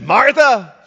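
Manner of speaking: an angry rebuke to a friend; word of anger. Retold or imitated. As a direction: snap the name out in anger. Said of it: "maybe a word of anger".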